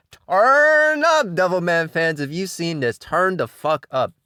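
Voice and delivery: loud, high yell, stretching the first words; hype shout. Real, not acted. Reviewer rallying fellow Devilman fans, hyped-up shouting.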